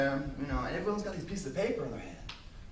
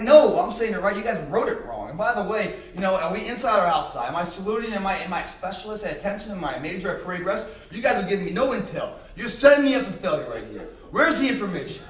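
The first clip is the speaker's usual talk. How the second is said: heated retort; 5 semitones higher, 8 dB louder than usual. Retold or imitated. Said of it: "I'm like".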